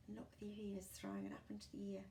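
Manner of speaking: fast unvoiced whisper. Narrator whispering to the child while watching, fast. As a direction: hurry the words out in a hushed voice.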